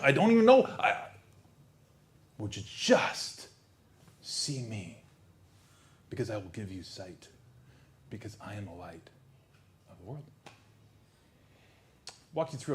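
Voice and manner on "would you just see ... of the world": soft tender invitation. Acted. Jesus softly inviting listener.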